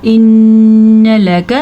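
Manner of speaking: इन्न लक with n hummed long through the nose; इन्न लक, sustained nasal n, short ka; long ghunna. Shown as a demonstration. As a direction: chant इन्न लक slowly, holding the nn. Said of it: "नून का गुन्ना".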